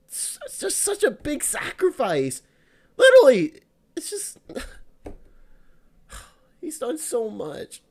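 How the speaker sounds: trying not to cry but breaking; fighting tears. Real, not acted. Streamer mourning a lost game character, voice cracking with tears. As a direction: voice cracking high with held-back crying, then a sighing hush. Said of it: "I'm trying not to cry".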